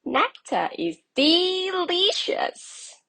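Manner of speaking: high, loud bumblebee voice; a bright, busy bee; buzzy bumblebee. A narrator's reading. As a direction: coax him with sweet treats. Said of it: "said a buzzy bumblebee".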